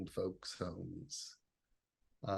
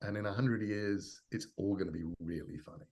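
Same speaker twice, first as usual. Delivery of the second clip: ordinary talk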